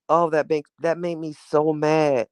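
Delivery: normal talking voice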